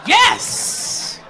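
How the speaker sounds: high-pitched, jubilant celebration; real elation, voice leaping up; excited. Real, not acted. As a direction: crow each yes like a victory cheer. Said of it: "Shit, I'm excited".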